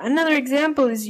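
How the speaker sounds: ordinary talk, nothing marked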